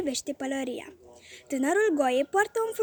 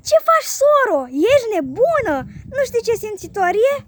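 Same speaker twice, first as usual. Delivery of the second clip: much higher and louder indignant scolding; outraged grandmother; 7 semitones higher, 10 dB louder than usual. A narrator's reading. Grandmother scolds the mother for shaking Goe, outraged. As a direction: rebuke your daughter in alarm. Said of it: "zice mamara".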